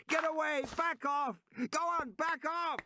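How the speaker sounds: high, frantic yells driving off an animal; desperate high-pitched shouting at a dinosaur; shouting at the top of his lungs. A narrator's reading. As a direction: scream it to scare the beast away. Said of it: "Regis was shouting at the top of his lungs".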